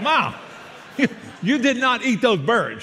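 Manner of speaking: retold disbelief, pitched high with a laugh; shocked son. Son shocked mother ate birds.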